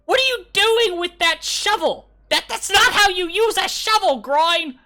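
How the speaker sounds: very high, loud exasperated goblin voice; shrieking goblin. Acted. Goblin on the statue scolding the digger, high shriek. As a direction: scold him in a piercing goblin screech. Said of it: "one of them says".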